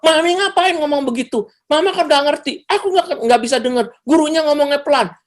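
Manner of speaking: put-on very high-pitched indignant kid voice; snappy child talking back. Child answering back to mother about the teacher, snappy and defiant. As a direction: talk back to mum, high and whiny-defiant. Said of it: "Malah nyolot".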